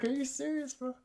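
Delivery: can't believe the kindness; stunned disbelief. Retold or imitated. Reaction to UPS paying duty.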